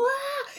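high-pitched open "aah"; imitated big "ah". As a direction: mimic a voice filling the whole space. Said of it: "era así como".

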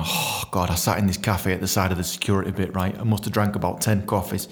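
ordinary talk, nothing marked

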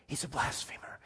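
soft, hushed whisper; whispered accusation. Retold or imitated. Onlookers whispering their suspicion to one another, whispering. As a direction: murmur it like a secret. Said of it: "and they went".

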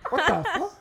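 high, laughing what the fuck; real laughing outburst; laughing disbelief. Real, not acted. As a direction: blurt it out mid-laugh. Laughing exclamation at story.

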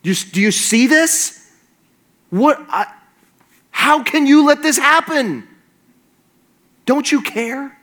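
high-pitched, incredulous outcry with halting starts; sputter, then protest in a high voice; exasperated disbelief. Acted. Believer turning to God about injustice, exasperated disbelief. Preacher acts out accusing God of neglect.

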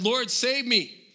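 loud, slow, high plea of a sinking man; desperate cry for help. Acted. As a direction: cry out slowly as if going under. Peter sinking cries to Jesus.

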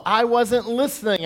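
usual speaking voice

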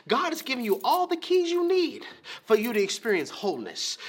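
ordinary talk

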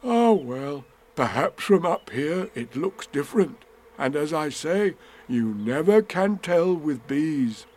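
lightly voiced storybook bear, musing; a hint of a thoughtful bear; Winnie the Pooh. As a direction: ponder it slowly at the end.

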